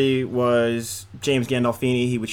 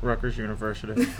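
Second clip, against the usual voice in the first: mumbled; 3 semitones lower, 6 dB quieter than usual. Pretend. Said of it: "speak up, please".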